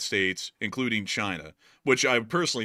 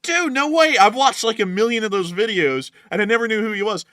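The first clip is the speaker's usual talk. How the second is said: high, loud burst of real surprise; astonished disbelief; 10 semitones higher, 8 dB louder than usual. Real, not acted. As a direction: blurt it out, amazed, pitch way up. Astonished to learn who it is.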